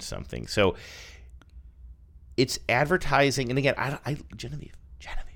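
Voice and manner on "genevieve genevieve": hushed unvoiced whisper of a name. Real, not acted. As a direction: whisper it to get her attention. Whispering to co-host mid-show.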